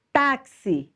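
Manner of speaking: Táxi with a high, strong TÁ; teacher's demo of Táxi, stressed TÁ. Shown as a demonstration. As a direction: punch the first syllable of Táxi. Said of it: "o tá é a sílaba mais forte".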